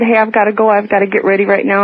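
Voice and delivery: ordinary talk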